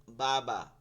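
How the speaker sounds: বাবা said with both lips pressed; বাবা, lips only, no teeth. Shown as a demonstration. Teacher demonstrating বাবা from the lips. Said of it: "বইলা দেখেন".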